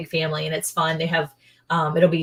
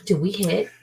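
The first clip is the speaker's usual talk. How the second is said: normal talking voice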